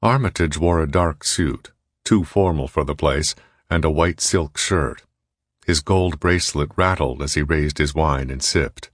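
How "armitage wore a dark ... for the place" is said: usual speaking voice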